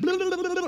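very high muffled blah noise; muffled babble. Retold or imitated. The girl's muffled yells under his fat, high babble. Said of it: "I kept hearing this noise".

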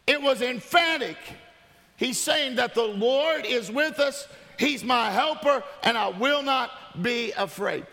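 high, driving preacher's delivery; rally the congregation, building urgency; full-force preaching. Real, not acted.

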